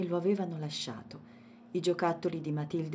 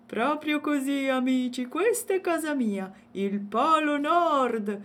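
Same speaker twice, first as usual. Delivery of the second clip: high, slow proud bear voice; 8 semitones higher, 8 dB louder than usual. A narrator's reading. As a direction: show off your home with pride. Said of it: "rispose Rodolfo orgoglioso". (orso orgoglioso, voce alta e lenta)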